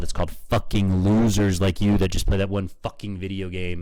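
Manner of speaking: loud, contemptuous rant; angry insult. Real, not acted.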